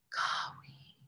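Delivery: soft breathy whispered Kawi; hushed whispered prayer sound. Shown as a demonstration. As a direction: whisper it intimately. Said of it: "we're gonna whisper this sound".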